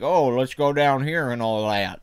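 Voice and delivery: slow West Texas drawl impression; Jeff Bridges. Host showing how West Texans talk, slow imitated drawl. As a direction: slur it slowly like a West Texas old-timer. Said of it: "Jeff Bridges in that movie, he's like".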